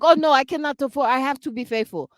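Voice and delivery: hammed-up, not-so-good African accent; bad accent. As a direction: attempt an African accent, badly, quick at first. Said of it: "that was not a good accent".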